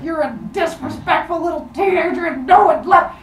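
high, strained angry rant; mock rage. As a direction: scold in a hammed-up fury. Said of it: "in a fit of rage".

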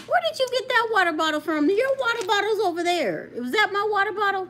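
startled scolding. Real, not acted. Scolding child over water bottle.

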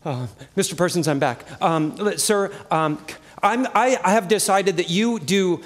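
normal talking voice

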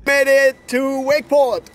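triumphant. Real, not acted. Triumphant arrival announcement.

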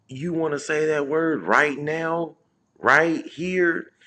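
high, slow, confrontational woman's voice; imitated female challenger. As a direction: mimic a woman daring him, drawn out and rising loud. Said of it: "some female was like".

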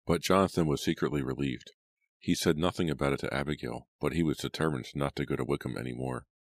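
plain reading voice

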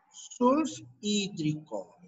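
very slow, split into sulf and hídrico; slow dictation. Shown as a demonstration. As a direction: stretch out "sulfhídrico" piece by piece. Dictating new term in parts. (dictado muy lento, por partes)

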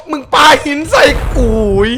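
high, very loud shout; outraged yell. Real, not acted. Gamer hit by a thrown rock, outraged high yell. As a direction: yell it at full volume, high-pitched. Yells as rock hits him.

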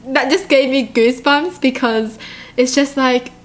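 usual speaking voice

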